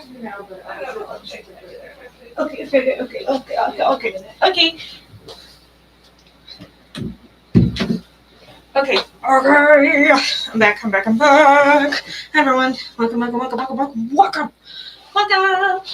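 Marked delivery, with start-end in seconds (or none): 2.4-12.0 loud, bouncy okays and I'm back; excited return. Real, not acted. Rushing back to the stream. 12.3-15.8 excited greeting. Real, not acted. Greeting chat after return.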